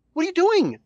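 high-pitched mock outrage; exasperated outcry. Host ranting about bringing veteran wrestler in, exasperated cry. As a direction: throw up a high, incredulous protest.

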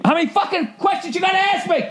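very high exasperated scream; strained, high-pitched rant; angry muted yell. Retold or imitated. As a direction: screech it, venting at the machine. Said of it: "yeah, on mute".

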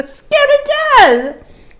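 high, loud, drawn-out mock shriek; hammed-up terror with a laugh in it; scared to death. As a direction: wail it slowly like a terrified big baby. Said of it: "I was like".

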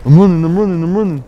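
high, loud, repeated battlefield call; urgent shout. Real, not acted. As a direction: shout it out on the run. Running into the game battle.

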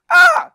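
a sudden, very high and loud "Ah!"; excited cry. Retold or imitated. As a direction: yelp of delight. Reacting to the president.

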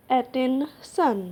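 Attention stretched slowly, syllable by syllable; slow model pronunciation. Shown as a demonstration. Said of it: "উচ্চারণ হলো attention". (ধীরে স্পষ্ট উচ্চারণ)

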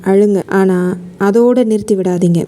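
ordinary talk, nothing marked

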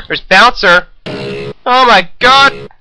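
slow, high, loud mock terror; mock panic. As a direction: stretch it out in hammed-up fright. Panicking on seeing the Bouncer.

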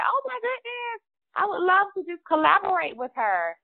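high-pitched, delighted recalled gush; excited reaction. Retold or imitated. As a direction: retell your own thrilled first reaction. Voices own delighted reaction to guest.

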